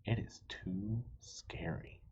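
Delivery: ordinary delivery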